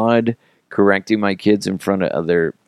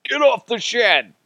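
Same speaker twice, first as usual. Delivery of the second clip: much higher put-on yell; SNL shed yell. Dad repeating the skit yell, high put-on voice. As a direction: bark it in the skit voice. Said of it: "That is totally me".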